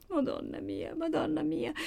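high, broken mild sobbing; a touch of crying in the prayer; weeping. Acted. Maddalena weeps over her sister, high and broken. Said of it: "seguitava a piangere Maddalena".

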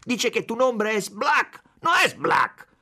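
broken Spanish-Italian, shouted; furious shout. A narrator's reading. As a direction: bellow it in his face. Chief shouting at the consul.

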